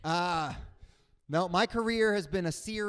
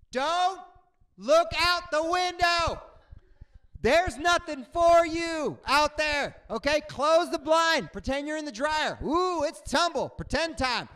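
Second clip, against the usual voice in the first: much higher, louder and slow, pleading urgently; emphatic warning; 11 semitones higher, 7 dB louder, slower than usual. Acted. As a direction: drill it into nervous flyers, slowly. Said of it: "advising scared flyers emphatically".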